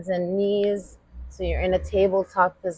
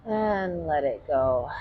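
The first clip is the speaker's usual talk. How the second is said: calm yoga instructor; 2 semitones lower, slower than usual. Acted.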